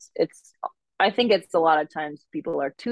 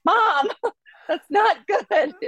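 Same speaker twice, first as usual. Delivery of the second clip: put-on much higher, slow, laughing Minnesota accent; 3 dB louder, slower than usual. Daughter scolds mom over the rate, laughing Minnesota accent. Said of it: "dial up that accent".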